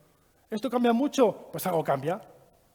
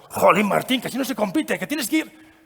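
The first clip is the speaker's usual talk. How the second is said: exasperated dad; 2 semitones higher, 4 dB louder than usual. Retold or imitated. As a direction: push your son to compete harder. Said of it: "Y yo pensando".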